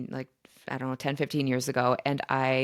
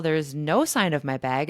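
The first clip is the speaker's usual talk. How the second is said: usual speaking voice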